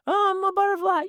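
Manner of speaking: dad voicing his son, very high child voice; little boy voice. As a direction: chirp it like a small boy. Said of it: "son voiced in story".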